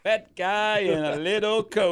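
loud, slow, high comic sing-song; Tommy Boy bit. Acted. Fan quoting Tommy Boy in the most serious moment, hammed-up sing-song. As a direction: draw it out big, like a goofy movie catchphrase. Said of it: "then the most serious moment".

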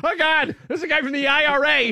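high, loud, laughing alarm; alarmed realization. Retold or imitated. Telling the story to hosts, high laughing alarm. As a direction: squeal in mock panic at the news. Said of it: "I'm like".

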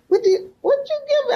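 high, fast, puzzled father voice; confused father. Retold or imitated. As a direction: play the blind father groping at a strange gift. Blind father confused by gift.

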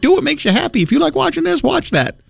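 high, gentle mock reassurance; reassuring. Reassuring wife caught watching TV.

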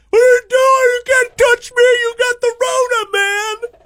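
very high-pitched panicked mock shriek; panicked freak-out. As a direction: freak out like someone terrified of being touched. Mimics horrified fist-bump victims.

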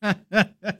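real, short burst of laughter; high, soft chuckling; amused laugh. Real, not acted. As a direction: laugh at an absurd clip. Amused by out-of-tune screamers.

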